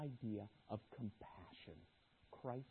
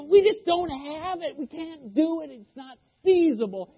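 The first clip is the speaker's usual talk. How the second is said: whiny disciples; 13 semitones higher, 12 dB louder than usual. Retold or imitated. Disciples protesting to Jesus.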